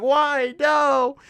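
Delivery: high, loud wail of disbelief; mock anguished cry. Fan reliving a beloved character's death, wailing. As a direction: cry out at the screen in mock despair.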